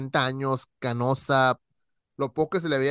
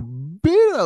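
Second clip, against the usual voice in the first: startled curse; 7 semitones higher, 7 dB louder than usual. Retold or imitated. Boy startled by old woman's face.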